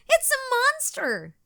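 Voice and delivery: high, loud mock-panicked cry; frightened yell. A narrator's reading. Girl poked in the dark crying out in mock fright. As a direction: sound the alarm in storybook fright. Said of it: "she yelled".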